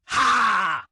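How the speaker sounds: loud, high "Ha!" shout; battle cry. A narrator's reading. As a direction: let out a forceful attacking yell. Attack cry in story battle.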